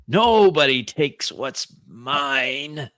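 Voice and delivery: slow, drawn-out possessive menace; slow theatrical old-man threat; older, frail villain. Acted. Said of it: "but older, frail".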